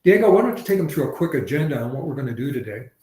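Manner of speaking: normal talking voice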